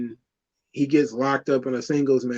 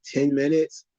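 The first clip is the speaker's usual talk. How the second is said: usual speaking voice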